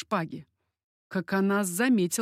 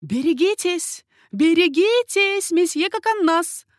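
high, loud, drawn-out warning; warning cry; 9 semitones higher, 7 dB louder, slower than usual. A narrator's reading. Lady at the window warning Coconnas, crying out. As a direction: call out high and urgent. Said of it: "воскликнула дама из особняка Гиза".

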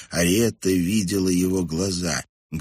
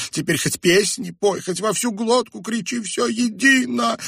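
very high, wailing lament; plaintive despair; 5 dB louder than usual. A narrator's reading. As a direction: give up, wailing hopelessly. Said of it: "жалобно сказала Хомели".

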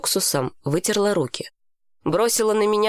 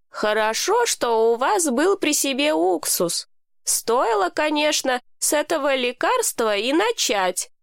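higher-pitched voice of an indulgent carer; a hint of amused approval, pitched up; lightly voiced Hani; 7 semitones higher than usual. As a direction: lightly act a knowing, kindly helper.

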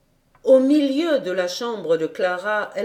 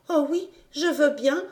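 put-on higher, breathless little girl; child's eager voice; 6 semitones higher than usual. A narrator's reading. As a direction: squeeze out a shy happy yes. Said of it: "put-elle enfin articuler". (petite fille émue, voix plus aiguë)

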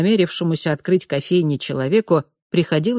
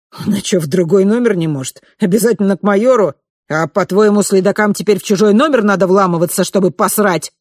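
irritated arguing; 4 semitones higher, 5 dB louder than usual. Acted. Guard argues with colleague.

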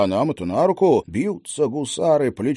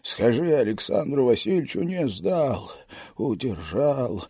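dying whisper. Acted. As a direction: speak like a dying man holding on. Dying Cossack speaks weakly.

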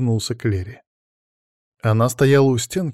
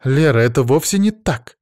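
louder, higher indignant protest; raised-voice contradiction; 4 semitones higher, 5 dB louder than usual. A narrator's reading. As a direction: object firmly, a touch outraged. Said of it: "возмутилась Лена".

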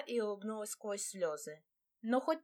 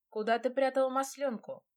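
with a chuckle; 6 semitones higher, 5 dB louder than usual. Acted. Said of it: "со смешком ответил".

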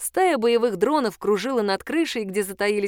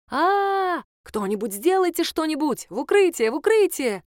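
panicked screaming; 8 semitones higher than usual. Acted. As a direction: yell for cover in terror. Police panic under gunfire.

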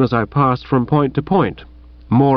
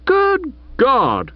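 high outraged exclamation; outraged cry; 12 semitones higher than usual. Acted. Chuffy cries out at his friend in outrage. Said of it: "cried Chuffy".

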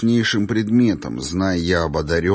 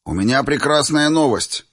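higher-pitched, ringing announcement; proclamation; 6 semitones higher than usual. A narrator's reading. Teacher announcing news to lined-up pupils, proclamation. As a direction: announce it grandly to the assembled class. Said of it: "провозгласил Мерген".

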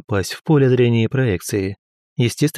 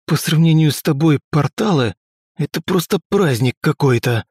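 rasping, breathless joke; hoarse wheeze; 4 semitones higher than usual. A narrator's reading. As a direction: croak it out after the blast. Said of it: "прохрипел я".

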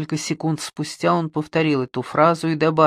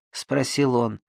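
ordinary delivery, nothing marked

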